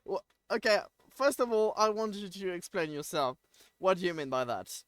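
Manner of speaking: high, loud and playfully affronted; mock indignation. Real, not acted. Streamer challenging a chatter who doubts the accent, mock-indignant. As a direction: demand an explanation, loud, in mock outrage.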